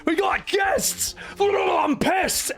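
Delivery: high-pitched, hammed-up furious yell; Cid's raging outburst. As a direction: explode at Shearer in a mock rage. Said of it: "immediately explodes into a rage".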